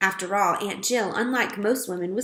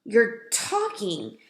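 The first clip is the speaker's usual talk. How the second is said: astonished whisper; 2 semitones higher, much slower than usual. A narrator's reading. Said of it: "in an astonished whisper".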